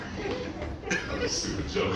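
words lost in wheezing laughter; laughing. Real, not acted. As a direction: say it while cracking up.

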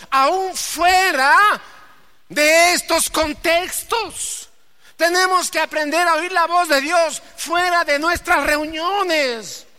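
high, loud, slowed preaching; preaching at full force. Real, not acted. Preacher urging the congregation, slow and loud. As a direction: drive the point home to the congregation.

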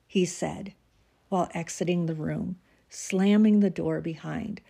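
normal talking voice

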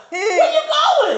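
high, slow, laughing call; mock calling out. Imitating the woman chasing her friend, high and laughing. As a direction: drag it out like calling someone back.

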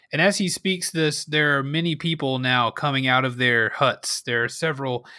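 ordinary talk

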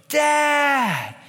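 put-on high, excited little girl's call of Dad; a two-year-old spotting dad at the door; toddler calling dad.

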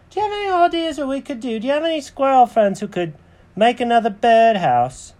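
high, fast cartoon cat; Jenkins asking eagerly. Cat asks his friend for ideas, high quick voice. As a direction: rattle the questions off up high. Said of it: "And Jenkins goes".

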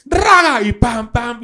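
high, shouted cue with vocal drum hits; imitated cue and hits. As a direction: yell the cue, then mimic the band. Acts out the frontman cueing the band. (grito agudo y golpes imitados)

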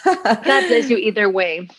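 normal talking voice

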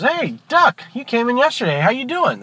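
high-pitched, chummy bartender greeting; friendly bartender. Acted. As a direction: greet a returning customer in a high, lilting voice. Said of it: "Bartender says".

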